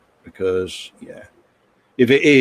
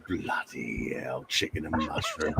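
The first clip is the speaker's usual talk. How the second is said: disgusted groan; 8 semitones lower, 14 dB quieter than usual. Real, not acted. To the stream chat, groaning in mock disgust. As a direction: groan it like it's revolting.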